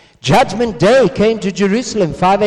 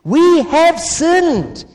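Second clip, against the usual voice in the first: higher, slow, pleading confession; anguished cry; 7 semitones higher, slower than usual. Retold or imitated. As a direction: cry it out like a convicted crowd. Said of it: "crying out".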